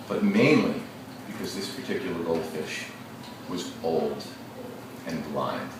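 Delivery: gentle classroom read-aloud; story read-aloud. Acted. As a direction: let the last words sink, old and blind. Teacher reads story aloud.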